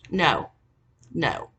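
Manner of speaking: usual speaking voice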